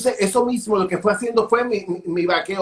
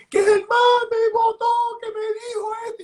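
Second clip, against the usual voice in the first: mock crying wail. Stylist blurts to the owner that he got fired, mock high wail. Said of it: "Y yo llorando".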